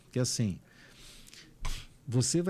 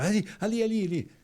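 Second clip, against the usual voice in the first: higher and louder, frantic pointing; panicked friend; 10 semitones higher, 4 dB louder, faster than usual. Retold or imitated. Friend afraid of cockroaches pointing, high frantic alarm. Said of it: "Mas onde você achou uma barata?".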